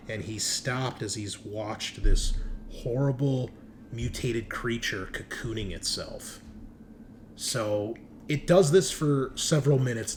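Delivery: usual speaking voice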